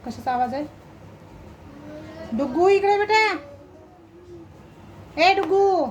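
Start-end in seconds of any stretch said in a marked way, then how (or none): 2.3-5.9 loud call to the dog across the house; calling the pet from a distance. Real, not acted. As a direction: raise the voice to call the puppy over.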